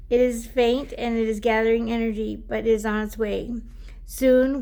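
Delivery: card reading to viewers; oracle card reading. Acted. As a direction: read the guidebook aloud like a gentle message. Reads the oracle card text.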